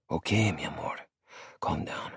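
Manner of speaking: soft hushed comfort; quiet him down tenderly; soothing. Acted. Maddie soothing crying Star, soft and hushed.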